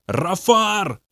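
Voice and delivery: high demonic cry of a name; demon calling out. A narrator's reading. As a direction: call out the name in a strange high voice. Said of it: "кричал Бобби". (высокий нечеловеческий зов демона)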